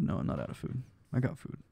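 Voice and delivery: soft muttering to himself. Real, not acted. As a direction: mumble it under your breath.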